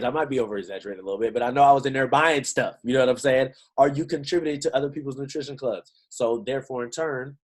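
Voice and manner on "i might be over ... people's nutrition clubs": ordinary talk, nothing marked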